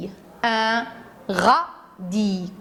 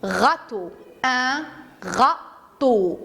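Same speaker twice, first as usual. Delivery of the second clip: Un râteau drawn out very slowly, each sound clear; teacher reading a new word slowly to children; slow careful reading; much slower than usual. Shown as a demonstration. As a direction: stretch Un râteau out for the class to hear. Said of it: "Notre dernier mot est le mot".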